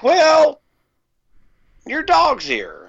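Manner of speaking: high, slow mock guard voice; mimicking the sergeant on the phone; guard's voice.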